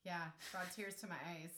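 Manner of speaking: touched, quietly admit you are tearing up; real choked-up emotion, ending in a laugh; tearful. Real, not acted. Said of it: "she really is crying".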